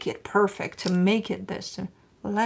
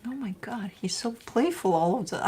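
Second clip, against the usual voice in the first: ordinary delivery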